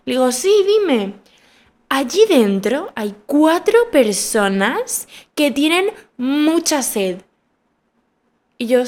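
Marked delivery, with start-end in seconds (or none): 0.3-1.1 high-pitched obliging answer, acted out; smiling reply. Receptionist answering the shouting visitor with a smile, acted smiling reply. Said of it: "con una sonrisa en la cara". 1.9-7.2 slow, high, over-enunciated mock order; mimicked haughty demand. Visitor ordering water from the receptionist, mimicked haughty demand.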